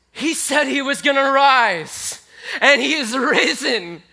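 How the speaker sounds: excited realization. Acted. Mary realizing her risen teacher stands there, joyful shout. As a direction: shout it like the news just hit. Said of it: "all this hope and excitement fills her".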